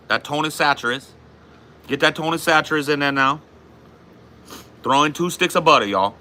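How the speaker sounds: high-pitched Cajun cooking host; put-on Louisiana Cajun accent, pitched well up. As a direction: play a Cajun cook announcing the recipe. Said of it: "How's your Cajun accent so good?".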